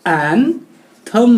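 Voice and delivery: एंथम very slowly, "an... thum"; teacher drawing out एंथम, ending "thum"; syllable-by-syllable pronunciation. Shown as a demonstration. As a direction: split एंथम in two with a pause. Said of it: "क्या?".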